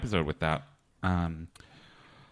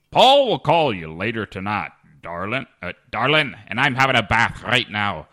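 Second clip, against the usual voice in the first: put-on Texas accent; 2 semitones higher, 6 dB louder than usual. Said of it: "then a Texas accent".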